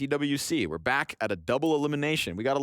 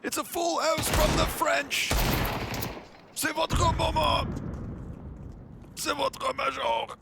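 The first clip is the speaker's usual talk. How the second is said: announcer voice; 13 semitones higher, slower than usual. Pretend. Caster imitating French crowd announcer.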